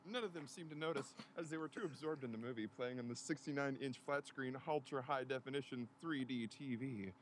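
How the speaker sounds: ordinary talk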